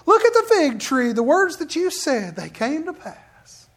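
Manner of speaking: high, loud, eager calling out to the Lord; excited Peter. Retold or imitated. Peter telling Jesus about the fig tree, high and excited. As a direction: blurt it out like an amazed disciple. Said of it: "Peter's the one that goes".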